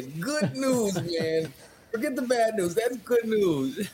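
high, drawn-out, delighted; gleeful high voice. Real, not acted. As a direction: sing out the good news, grinning. Gleeful repeat of good news.

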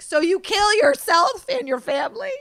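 high, loud mock disbelief; incredulous retort. Woman retelling her reply to a bear hunter, incredulous. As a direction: throw it back as an appalled, high-pitched question.

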